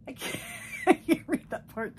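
giggling through the words; laughing while reading. Real, not acted. Reading a friend's messy card, giggling. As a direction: laugh as you give up on the scrawl.

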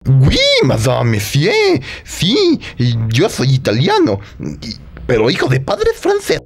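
put-on French-tinged Italian accent; hammy Italian painter voice; fake Italian accent. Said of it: "acento italiano parece más bien como francés". (acento italiano afrancesado)

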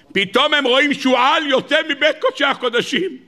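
high-pitched preacher's cry; dramatic narration. Acted. Preacher telling how the sages saw a fox, dramatic outcry. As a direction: cry out the shocking sight, high.

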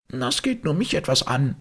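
high-pitched grumpy retort; sullen. A narrator's reading. Maid tells them it's her business, sullen and high. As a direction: snap back sullenly in a high voice. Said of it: "erwiderte das Weib mürrisch".

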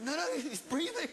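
high-pitched retold protest; urgent reassurance. Kicker answering frantic dog owner, urgently reassuring.

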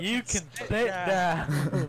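slow dismissive. Acted.

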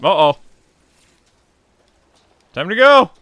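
alarmed. Real, not acted. Fleeing in the game.

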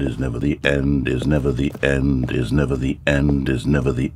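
low, even droning repetition; deep monotone loop. Acted. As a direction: chant it like a broken record. Narrator's looping repeated chant.

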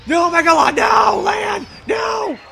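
high, loud cry of protest; shouting. Real, not acted. Player protests as Lan attacks, shouting high. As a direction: yell out in alarmed protest.

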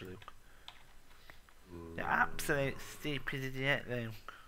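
normal talking voice

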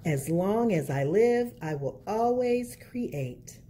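put-on lilting picture-book narration for kids; reading to children. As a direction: read it like storytime at the library.

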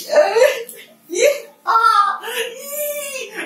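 high squealing cries of joy; delighted squeal. Real, not acted. Baby picks mother, squeals. (jeritan gembira)

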